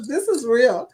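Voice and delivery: laughing it out, amused. Real, not acted. Streamer laughing at a chat comment, laughing. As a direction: giggle through the words.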